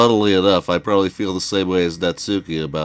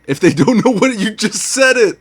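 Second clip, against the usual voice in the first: much higher and louder, triumphant; gleeful gotcha; 5 dB louder than usual. Real, not acted. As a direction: pounce on the slip, crowing. Catching him saying the word.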